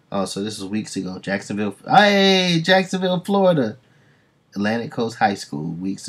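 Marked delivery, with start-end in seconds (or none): 1.9-3.8 excited shout-out. Real, not acted.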